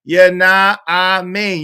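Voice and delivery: normal talking voice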